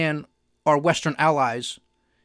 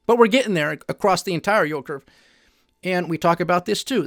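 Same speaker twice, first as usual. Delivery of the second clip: usual speaking voice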